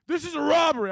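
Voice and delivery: high, hammed-up hold-up announcement; high comic robber voice; mock robber.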